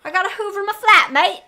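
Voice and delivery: loud, high, over-the-top British greeting; mock British accent. Shown as a demonstration. Podcaster showing off her British accent to co-host, hammed-up British. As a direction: ham up a chirpy Cockney-style British accent. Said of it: "prep for my British accent".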